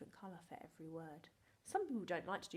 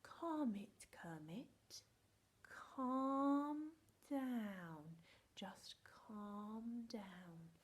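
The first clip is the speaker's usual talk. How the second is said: soothing, ending slow and whispered; calming down; 2 semitones higher, much slower than usual. Retold or imitated. Mum calming teacher down, fading to a slow whisper. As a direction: settle someone down gently. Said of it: "she just said".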